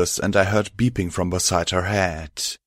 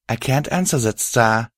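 usual speaking voice

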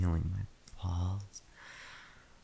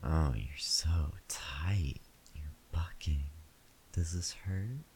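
whispering. Acted. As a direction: whisper close to the mic. Intimate whispered roleplay.

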